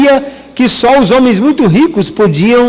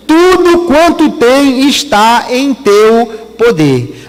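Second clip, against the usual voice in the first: slow, measured quote of God; solemn divine pronouncement; slower than usual. Retold or imitated. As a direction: pronounce it slowly, word by word. God pronouncing permission to the enemy. (citação solene e pausada de Deus)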